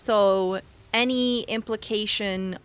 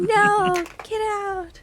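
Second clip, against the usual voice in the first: shooing; 11 semitones higher, 4 dB louder, slower than usual. Real, not acted. Shooing the cat Momo.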